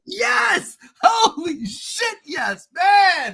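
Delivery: ecstatic cheering. Real, not acted. Host cheers his favorite movie's birthday, shouting with joy. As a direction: yell it like your team just scored.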